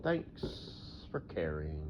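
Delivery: slow, soft, deadpan thanks; dry sarcasm. Acted. Thanking the imagined asker, dry and sarcastic. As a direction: drag out "Thanks for caring" slowly and quietly.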